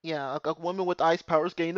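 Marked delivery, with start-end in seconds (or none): none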